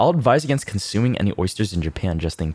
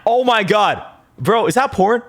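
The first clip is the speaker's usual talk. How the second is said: high, loud and aghast; shocked disbelief; 13 semitones higher, 4 dB louder than usual. Real, not acted. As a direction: blurt it out in alarm. Reacting to porn under tweet.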